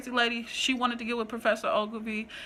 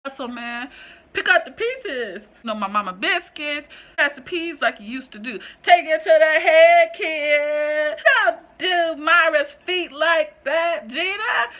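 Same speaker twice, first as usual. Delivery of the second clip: loud, high, hammed-up sitcom voice; loud, high Martin-style shouting; Martin catchphrases; 6 semitones higher, 9 dB louder than usual.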